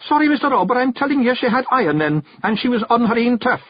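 a lad confessing his fear for her; young Billy, earnest and scared. A narrator's reading. Billy tells Rob his fear for the hag, frightened high voice.

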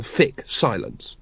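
ordinary delivery

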